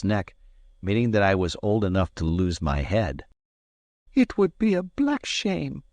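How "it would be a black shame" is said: indignant maid. Acted. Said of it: "she cried".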